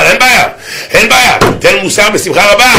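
high, eager preaching lilt; animated, ringing go-ahead; emphatic reassurance. Acted. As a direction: grant it gladly with lifted pitch.

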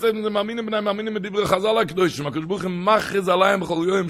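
high, fervent sing-song, easing toward the end; full-force preaching. Acted. Rabbi exhorting listeners about news from Heaven, full-force preaching. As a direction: proclaim it high and insistently.

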